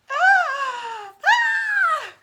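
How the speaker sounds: loud, very high frightened scream; startled scream. Retold or imitated. Woken by roommate at her bed, screaming in fright.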